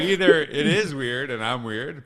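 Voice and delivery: loud, slow laughing admission; a real laugh over the words. Real, not acted. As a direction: laugh it out at yourself. Laughing at herself.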